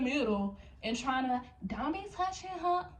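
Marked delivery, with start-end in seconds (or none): 1.7-2.9 higher pitched imitation of coworker; mock coworker voice. Voicing SpongeBob stepping in.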